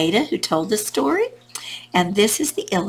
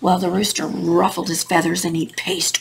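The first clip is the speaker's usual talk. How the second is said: plain reading voice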